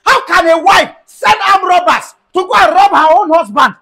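loud, high shout calling the neighbours; angry shouting. Acted. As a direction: bellow it so the whole street hears. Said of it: "why are you shouting?".

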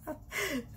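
quiet high giggle; small breathy chuckle; soft giggle. Real, not acted. Laughs at her own rant.

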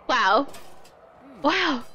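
normal talking voice

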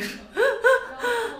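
high, crying-like laugh at herself. Real, not acted. Vlogger laughing at her own mind going blank.

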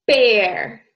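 Bear, lips pressed for a strong b; loud clear model of Bear; lips together b. Shown as a demonstration. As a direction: pop the b in Bear. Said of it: "Our lips go together".

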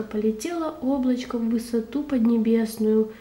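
ordinary delivery, nothing marked

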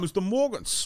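soft goofy sitcom intro; playful celebrity impression; Tracy Morgan. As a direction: announce it in an oddball comic's voice. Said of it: "idea of the Tracy cast".

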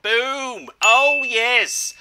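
triumphant gamer hype; excited celebration. Real, not acted. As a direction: celebrate a win with a big swooping shout. Celebrating a gingerbread drop.

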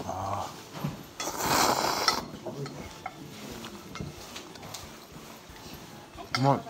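breathing out after hot food; talking while eating noodles. Real, not acted. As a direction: mouth partly full. Seen 0: Chopsticks in bowl, lips pursed breathing out steam between noodle bites.

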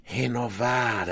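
soft, drawn-out otherworldly voice; voices of lights. A narrator's reading. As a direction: softly intone it, stretching the word. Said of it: "as luzes diziam". (voz das luzes, suave e lenta)

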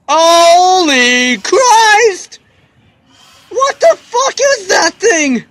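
loud, high-pitched cry of alarm; shocked outcry. Real, not acted. Player seeing the monstrous boss appear, shocked. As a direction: yell it in horrified disbelief.

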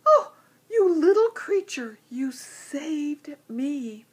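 high, loud, crying out in gratitude, then slow; a lion overcome with thanks; moved lion. Acted. Lion thanks the tiny bee, tearful and high.

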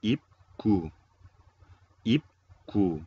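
"ip ku" as two loud, separated syllables; teacher modelling "ip", pause, then firm "ku"; model pronunciation. Shown as a demonstration. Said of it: "Las dos pronunciamos". (pronunciar "ip ku" como modelo, sílabas fuertes y separadas)

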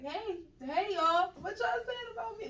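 high, loud hey for attention; acted calling out. Dreamer to tall people talking over her, high acted call.

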